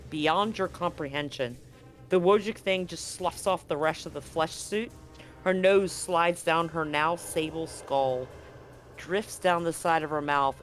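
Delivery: usual speaking voice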